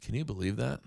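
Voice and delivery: deadpan calm, barely projected; calmest voice. Retold or imitated. Coworker to his neighbor after a full tantrum, eerily calm. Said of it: "In, like, the calmest voice".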